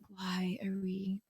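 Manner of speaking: slow whisper with a laugh inside; whispered aside. Retold or imitated. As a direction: hush it, amused and baffled. Kid baffled by old songs.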